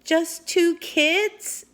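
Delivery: put-on high, slow little-girl voice; small voice. A narrator's reading. Annie answering the shouting captain, small high voice. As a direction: answer timidly, tiny and drawn out. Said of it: "answered in a small voice".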